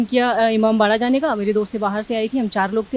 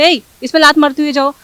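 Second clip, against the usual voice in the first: "ए" barked loud and higher, a bossy shout; rude staff order; 4 semitones higher, 10 dB louder than usual. Retold or imitated. Retelling the staff member's rude order to the interviewer, loud mock bark. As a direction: imitate the guard ordering her, harsh and rude. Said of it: "आप उसी tone में बताएं जिस tone में उन्होंने बात".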